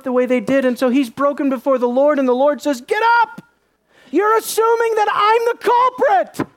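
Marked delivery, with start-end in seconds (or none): 2.8-6.5 high, forceful indignant shout; shouted rebuke. Acted. God to broken Joshua on the ground, indignant shout. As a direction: cry it out high, as an outraged command.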